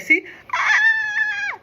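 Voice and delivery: quick "See?" then a very high, loud falsetto shriek; high-pitched female scream. Retold or imitated. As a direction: squeal in a high falsetto as if startled. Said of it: "he just did this high-pitched female scream".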